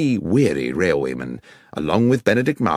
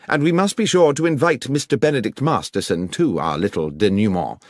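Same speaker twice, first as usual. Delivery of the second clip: crisp, commanding detective, higher and brisk; Holmes taking charge; 7 semitones higher, 4 dB louder, faster than usual. Acted. Holmes instructs the inspector to gather the suspects, brisk and commanding. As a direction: take charge of the case with quick, clipped orders.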